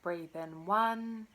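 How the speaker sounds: slow, drawn-out counting with long pauses; guided breathing count. Real, not acted. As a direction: pace each number to a long, steady breath.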